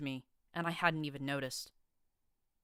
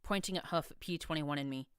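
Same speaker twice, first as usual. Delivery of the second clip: usual speaking voice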